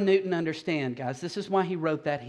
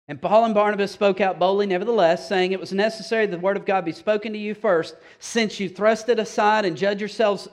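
loud, emphatic preaching read; 3 semitones higher, 6 dB louder than usual. Acted. Pastor reading Paul's bold words, preaching loudly. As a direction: proclaim it boldly to the room.

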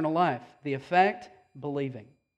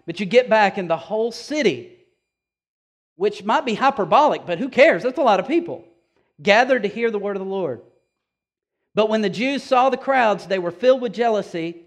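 raised, louder preaching voice; 4 semitones higher, 7 dB louder than usual. Acted. Pastor to the congregation, preaching at full force.

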